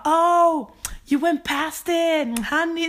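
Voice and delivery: high, loud, slow sassy drawl; sassy bus driver. Retold or imitated. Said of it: "alltså sassy".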